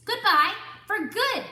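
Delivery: high, loud final goodbye; angry dismissal. Acted. Duck sends the animals away, high loud goodbye. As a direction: slam the door on them. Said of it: "said Mister Duck".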